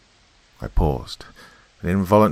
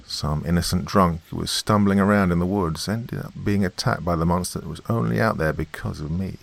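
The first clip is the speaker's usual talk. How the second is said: ordinary delivery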